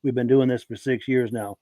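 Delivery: usual speaking voice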